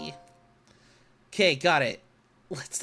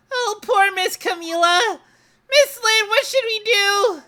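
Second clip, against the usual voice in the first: high, louder, anxious dog voice; worried little dog; 11 semitones higher, 6 dB louder than usual. Acted. Missile fretting over Camila, high and anxious. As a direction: fret like a small dog.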